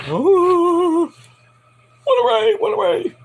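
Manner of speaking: very high falsetto wail; mock dazed reaction. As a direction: put on a drawn-out, high dazed voice. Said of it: "leaving Grayson a bit kinda like".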